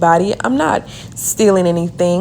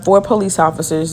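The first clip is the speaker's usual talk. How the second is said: usual speaking voice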